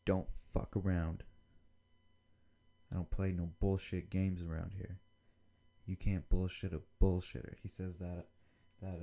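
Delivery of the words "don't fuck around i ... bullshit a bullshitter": a loud, stern boss laying down the law; angry boss. Retold or imitated. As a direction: snap at the candidate like a tough boss. Boss confronts lying interviewee.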